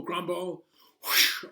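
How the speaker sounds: plain reading voice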